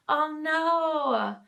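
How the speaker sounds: high, pained little cry of sympathy; dismayed oh no. Real, not acted. Hearing about milk spilled on pants and couch, a dismayed oh no.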